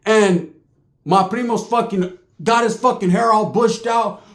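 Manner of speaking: ordinary talk, nothing marked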